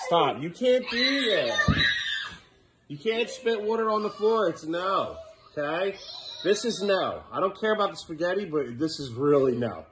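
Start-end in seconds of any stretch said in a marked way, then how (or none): 0.1-4.5 higher, slow, stern telling-off; sharp scolding. Real, not acted. Owner scolding Brody for spitting water, stern. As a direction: stop him cold.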